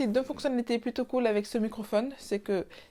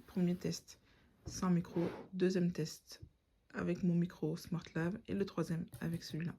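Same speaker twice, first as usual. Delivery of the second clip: usual speaking voice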